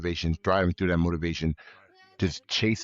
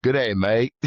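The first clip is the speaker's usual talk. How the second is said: higher, louder mock Australian greeting; Australian accent attempt; 4 semitones higher, 5 dB louder than usual. Shown as a demonstration. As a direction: put on an Aussie accent for a hearty greeting. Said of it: "do it in an Australian accent".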